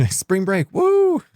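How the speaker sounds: put-on very high, peppy party-girl cheer; hammed-up spring break cheer; mock party whoop. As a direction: squeal it, then a loud high woo. Mimics the actress's peppy high voice.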